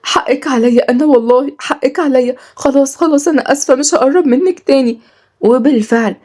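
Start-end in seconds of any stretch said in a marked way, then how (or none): 0.0-5.0 high, tearful apology; crying. A narrator's reading. Girl says sorry to injured servant, crying. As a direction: apologise as if crying, pitch raised. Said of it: "وقالت بعياط".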